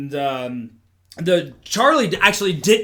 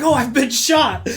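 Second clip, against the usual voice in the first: slow, higher-pitched mock wounded cry; bad fake acting; 8 semitones higher, slower than usual. Faking a gunshot wound for onlookers, hammed-up bad acting. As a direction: announce it like a terrible actor. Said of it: "his acting is horseshit".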